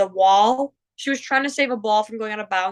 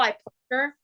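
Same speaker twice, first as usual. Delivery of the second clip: ordinary delivery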